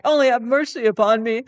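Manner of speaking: high, loud, fast desperate pleading; terrified man on his knees begging; begging for mercy. Acted. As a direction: beg for your life. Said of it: "he cried".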